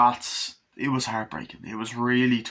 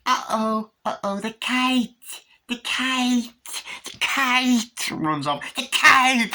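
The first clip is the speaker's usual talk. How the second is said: put-on very high child voice rising from uh-oh to a loud cry; little boy running after his kite; toddler voice building to a shout; 14 semitones higher, 5 dB louder, slower than usual. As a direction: chase the kite getting louder each time. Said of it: "And he's like".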